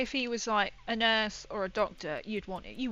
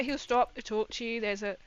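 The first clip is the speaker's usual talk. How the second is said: ordinary talk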